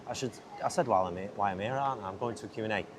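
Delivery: ordinary talk, nothing marked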